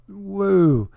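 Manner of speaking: high, drawn-out "woo"; long high-pitched woo; whooped woo. Real, not acted. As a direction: let out a high woo of amazement at a spike. Reacts to chart's massive spike.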